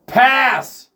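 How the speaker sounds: loud, high one-word yell; sudden shout. Real, not acted. Friend cutting into the chat, sudden shout. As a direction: holler it out sharply.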